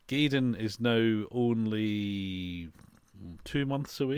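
slow, weighty reveal; dramatic announcement. Acted. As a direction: drag out each word like a trailer voice. Dramatic announcement of big race.

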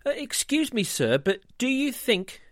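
high, timid polite voice; polite goose. Acted. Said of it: "Goose politely began".

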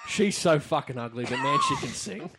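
quoting the crowd through laughter; laughing mock quote. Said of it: "everyone was like".

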